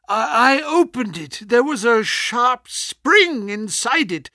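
ordinary delivery, nothing marked

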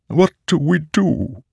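a remorseful living sword; talking sword. Acted. Nightblood voice.

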